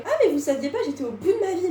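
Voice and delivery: casual self-mocking quote. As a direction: toss it off like old news. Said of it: "je suis ce genre de personne qui est en mode".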